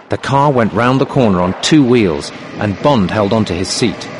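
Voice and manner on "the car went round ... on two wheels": plain reading voice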